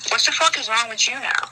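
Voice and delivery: baffled, fed-up quote; retold exasperated demand. Retells confronting mumbling parents.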